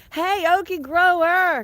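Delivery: high, loud, slow greeting; cheery call. Real, not acted. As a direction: call out a happy hello to a friend. Greets a viewer in chat.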